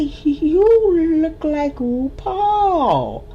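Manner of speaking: high, very slow wondering voice; starstruck remark. Retold or imitated. Narrator telling the rescuer she looks like [name], slow and amazed. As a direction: draw it out in amazement.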